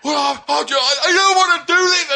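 mock tearful plea. Manager backing down in tears, mock tearful stammer. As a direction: whimper like a grown man breaking down. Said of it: "And then he's just gone".

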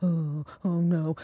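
slow, soft, sheepish little "oh no" like a guilty puppy. Retold or imitated. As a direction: whimper it like a drunk boyfriend caught out. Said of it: "He was literally like a puppy".

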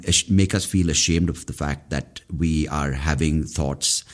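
ordinary talk

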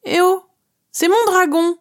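high-pitched jealous cry; jealous outburst. A narrator's reading. Girl protesting to the huge dragon, jealous cry. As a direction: cry out possessively, high and impulsive. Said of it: "Jalousement, je m'écrie sans trop réfléchir".

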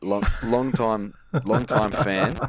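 slow, drawn-out gym bro voice. Pretend. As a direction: put on a lazy gym bro drawl. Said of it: "is that how you talk?".

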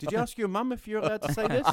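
high, fast taunting question; mock the man's childishness; mocking retort. Taunting a man who checked with his mum, mocking. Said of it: "said that back to him".

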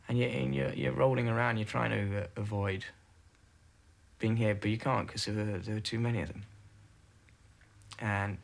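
usual speaking voice

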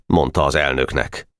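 plain reading voice

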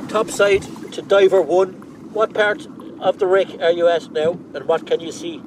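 loud, high radio callout. Real, not acted. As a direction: hail the diver over the radio. Boat calls diver on radio.

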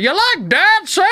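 put-on high, slow, loud old lady voice; imitating a loud old lady. Old lady asks him if he likes dancing, loud and high. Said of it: "She was that, that loud".

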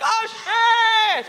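high, loud yelp of panic; panicked shout. Retold or imitated. Realising her mistake, a high loud panicked shriek. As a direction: shriek it as the penny drops. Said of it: "And I'm like".

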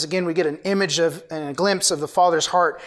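ordinary talk

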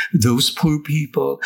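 usual speaking voice

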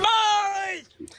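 calling out. Real, not acted. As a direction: shout the greeting across a paddock. Caller greets hosts calling out.

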